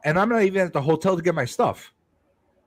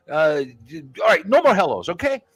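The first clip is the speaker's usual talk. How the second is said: ordinary talk, nothing marked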